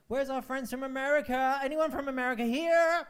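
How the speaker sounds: high, loud shout to the back of the room; calling out to the crowd. Real, not acted. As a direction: holler out for audience members. Calling out to audience members.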